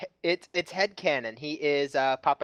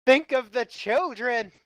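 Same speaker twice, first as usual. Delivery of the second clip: drawn-out, higher-pitched mock outrage; mock plea; 8 semitones higher, slower than usual. Host to crude co-hosts, hammed-up moral plea. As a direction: plead slowly and high in pretend alarm.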